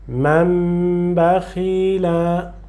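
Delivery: man bakhila very slow, n turned into a long nasal m before b; slow joined recitation. Shown as a demonstration. Teacher reciting man bakhila for the student, n merged into m. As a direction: glide man into bakhila as mamb, slowly. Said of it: "dizer ou falar man bakhila".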